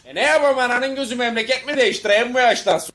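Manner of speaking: high, loud protest; indignant retort. Acted. Comedian answering critics of his regional accent, indignant retort. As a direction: fire back at the critics, exasperated.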